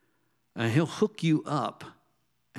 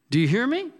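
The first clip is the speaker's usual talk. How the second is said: higher, louder challenge to the room; preaching call; 10 semitones higher, 4 dB louder, faster than usual. Real, not acted. A preacher pressing the congregation, raised and higher. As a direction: call out to them, demanding an answer.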